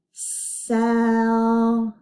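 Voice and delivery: drawn-out single breath; stretched smooth blend. Shown as a demonstration. Teacher blending a word for the class, stretched. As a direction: hold each sound into the next, long and slow. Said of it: "Let's put the s sound at the beginning".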